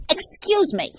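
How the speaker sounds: high, loud teacherly interruption; indignant reprimand. A narrator's reading. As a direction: cut in like an offended teacher. Teacher reprimands pupil, as earlier.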